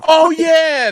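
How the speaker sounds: loud, high "oh yeah" of realizing; jolted into remembering; sudden recognition. Real, not acted. Recognizing the clip his friend describes, with a loud surprised oh.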